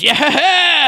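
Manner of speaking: high, loud triumphant yell; Vincent's victory cheer. Retold or imitated. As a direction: cheer like you just topped the pyramid. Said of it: "when Vincent goes".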